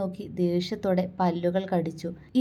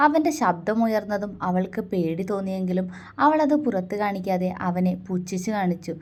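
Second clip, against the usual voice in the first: usual speaking voice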